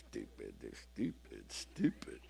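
low, soft, muttered self-insult; mock self-insult. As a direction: mutter "stupid" under your breath at yourself.

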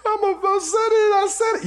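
very high whiny wail; hammed-up crybaby complaint; mock crying. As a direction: bawl it in an exaggerated tearful whine. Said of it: "then they cry and go".

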